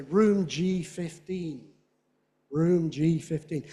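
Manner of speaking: loud, slow "G" with a long vowel; stressed letter name. Shown as a demonstration. Teacher modelling alphanumerics, loud stressed G. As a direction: give "room G fifteen" big, slow, separate beats. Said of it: "loud and stressed".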